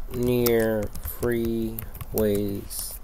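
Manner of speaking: very slow, stretched-out words; drawn out while typing. Real, not acted. Typing a search on homeless people near freeways, slowly drawn out. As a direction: draw each word out as you type it in. Types the words as she speaks.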